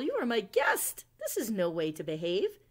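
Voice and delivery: loud, indignant scolding; scolding cry. Acted. Owl scolding Winter for bad manners, loud and indignant. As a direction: scold the rude guest loudly. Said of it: "cried Owl".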